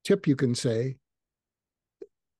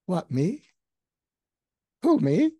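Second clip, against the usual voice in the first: surprised, rising much higher on who me; flattered surprise; 4 semitones higher than usual. Retold or imitated. Flattered person reacting to praise, rising to a high surprised squeak. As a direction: act flustered at the compliment. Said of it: "they're gonna go".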